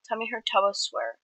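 ordinary delivery, nothing marked

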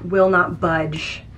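ordinary delivery

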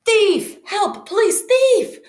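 high, loud cry of alarm; calling for help. A narrator's reading. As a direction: raise the alarm across a marketplace. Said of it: "Condi heard a voice call".

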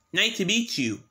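ordinary talk

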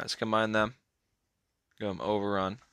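normal talking voice

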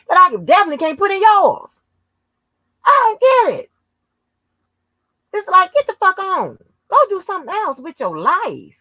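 loud, high-pitched exasperation; exasperated rant. Real, not acted. Telling off an absent rival, high exasperated outburst. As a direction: push the voice way up high and loud, fed up.

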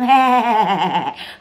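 long cackle trailing downward; drawn-out derisive laughter; mocking laugh. Real, not acted. Streamer joking about five legal complaints, mocking laugh.

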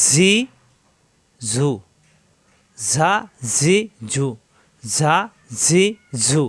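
ঝা, জি, ঝু as buzzing "zaa, zee, zoo"; separate, drawn-out z syllables ঝা, জি, ঝু; pressed whistling z. Shown as a demonstration. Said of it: "জিহ্বা নিচে তাতে চাপ দিয়ে".

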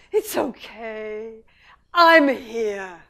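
slow, tender reassurance; soothing mother. Retold or imitated. Mother reassures her upset baby, slow and tender. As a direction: comfort gently like a mother. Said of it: "The mother says".

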